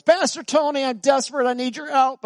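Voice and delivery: high, loud pleading voice; desperate youth. Retold or imitated. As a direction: act out a frantic teen begging for help. Retold desperate phone call from youth.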